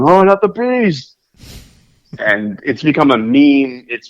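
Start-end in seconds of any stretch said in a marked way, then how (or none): 0.0-1.2 high, drawn-out mock wail; Nicolas Cage. Podcaster quoting the famous meme line, mock-panicked wail. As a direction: imitate an actor's hammy, slow cry of panic. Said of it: "is Nicolas Cage going".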